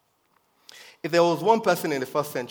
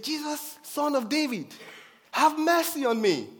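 much higher, loud desperate cry; shouting for mercy; 13 semitones higher, 4 dB louder than usual. Retold or imitated. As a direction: cry out to be heard over the crowd. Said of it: "he shouted at the top of his voice".

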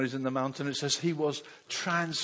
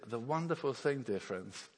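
ordinary delivery

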